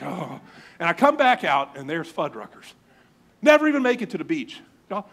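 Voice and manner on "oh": soothed. Acted. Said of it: "Just soothe, I'm like".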